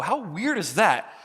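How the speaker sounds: ordinary talk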